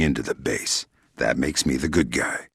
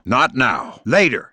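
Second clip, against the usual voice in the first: impatient brush-off; 7 semitones higher, 3 dB louder, slower than usual. Acted. Brushing off caller mid-fight.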